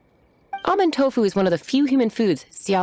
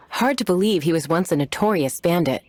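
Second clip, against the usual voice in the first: ordinary delivery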